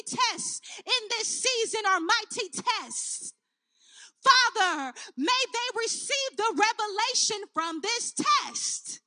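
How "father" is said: normal talking voice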